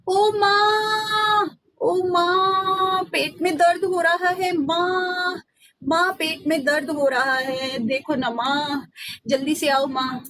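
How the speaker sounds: hammed-up sick-boy whine; fake tummy ache. Ram fakes pain again to mother, hammed-up wail. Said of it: "बहाना लगाना शुरू किया".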